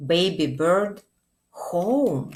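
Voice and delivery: plain reading voice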